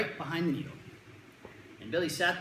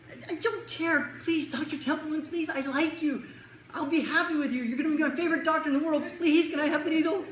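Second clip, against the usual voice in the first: put-on pleading kid; 10 semitones higher, 3 dB louder than usual. Kid begging Doctor Templeman for the needle, high pleading. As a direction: beg sweetly like a child.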